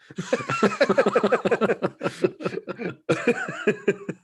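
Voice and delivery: long high real laugh at his own story. Real, not acted. After telling his mate the prank, long real laugh.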